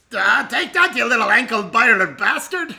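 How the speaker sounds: loud, high battle cry; battle yell. Acted. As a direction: roar it at the enemy. Character yells during attack.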